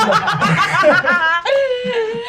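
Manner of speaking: high helpless laughter. Real, not acted. GM laughs at his own voice.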